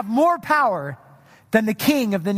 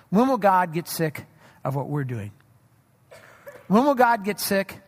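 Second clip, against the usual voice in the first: ordinary delivery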